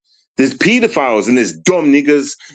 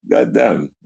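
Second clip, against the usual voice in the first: ordinary talk, nothing marked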